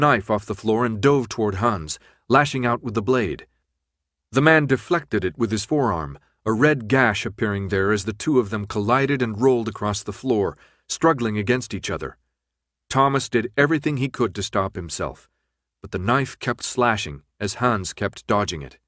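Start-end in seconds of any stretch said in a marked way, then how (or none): none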